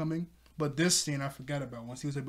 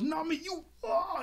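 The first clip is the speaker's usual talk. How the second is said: mock outrage; 14 semitones higher, slower than usual. Zoro sliding down the oil.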